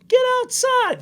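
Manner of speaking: very high-pitched mock command; dad ordering. Dad sending son back to the family, high retold order. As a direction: send the kid out in an imitated dad voice.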